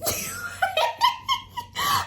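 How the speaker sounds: high breathy burst of laughter; real giggle fit. Real, not acted. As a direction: break up laughing. Laughing at her own story.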